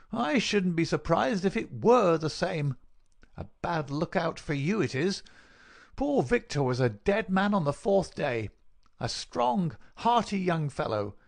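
high-pitched, insinuating visitor's voice; gloating villain. Acted. Visitor hints at poor Victor's death, gloating. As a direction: toy with him in mock sympathy.

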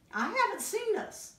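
squeaky high little cub; high tiny bear voice. Acted. Smallest snow bear teasing Mommy, high and cheeky.

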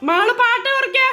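loud, high call over the music; shouting across the house. Real, not acted. Mother shouts to Malu to turn the song down. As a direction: yell for the music to go down.

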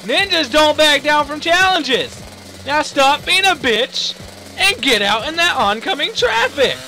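loud, high mock drill order; bossy pep talk. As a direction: goad a reluctant dummy into action. Mock pep talk to ninja dummy.

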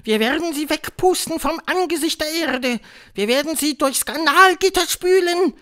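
high, loud witch tirade; screaming Grand High Witch. A narrator's reading. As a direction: screech threats like a ruling witch. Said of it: "schrie die Hoch- und Großmeisterhexe". (schreiende Hexentirade, hoch und laut)

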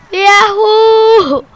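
loud, high, drawn-out cheer; a thrilled holler; joyful whoop. Real, not acted. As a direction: whoop it out with glee. Cheering while flying in game.

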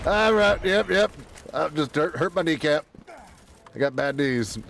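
ordinary talk, nothing marked

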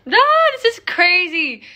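loud, high amazement; excited disbelief. Real, not acted. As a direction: cry out in disbelief. Hit six million followers.

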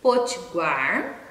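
"Potiguar" slow with every syllable clear; slow careful "Potiguar"; careful word. Shown as a demonstration. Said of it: "Quais são as palavras em português?".

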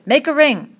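high, loud command; shout. A narrator's reading. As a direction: shout the order to everyone. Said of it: "Jack shouted".

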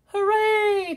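freed fish cheering their rescue. Acted. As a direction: cheer with relief. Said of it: "cheered the fish".

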